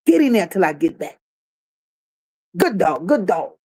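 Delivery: voz grave de amo con su perro. Retold or imitated. Voicing Tone caging Ileana.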